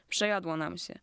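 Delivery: ordinary delivery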